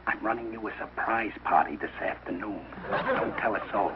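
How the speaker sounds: soft, low, conspiratorial; confiding secret. Acted. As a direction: share it quietly and keep it between you two. Confides surprise party to son.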